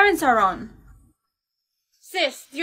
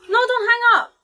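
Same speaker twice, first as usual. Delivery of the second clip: high-pitched, desperate plea; panicked rise in pitch; urgent pleading; 6 semitones higher than usual. Acted. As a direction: beg the caller to stay on. Caller is cutting off.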